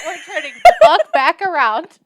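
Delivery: high-pitched comic alarm through laughter; laughing mock panic. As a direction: crack up while bailing out. Comic panic, fleeing the owl.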